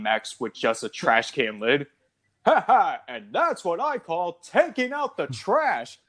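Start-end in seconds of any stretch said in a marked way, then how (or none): 2.5-6.0 high, slow gloating one-liner; cheesy action-hero quip, mock triumphant; hammed-up pun. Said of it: "these puns".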